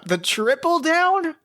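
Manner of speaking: squeaky high, slow thrilled exclamation; delighted. Acted. Veld thrilled at Shen's denial, high delighted squeal. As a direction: pitch it way up in glee.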